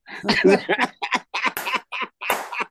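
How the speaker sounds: long, high chuckling; amused laughter. Real, not acted. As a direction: laugh it out after the punchline. Laughter at Christmas punchline.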